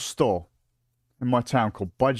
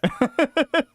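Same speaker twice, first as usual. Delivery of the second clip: high burst of laughter; 14 semitones higher than usual. Real, not acted. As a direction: cackle at the memory. Laughing at own story.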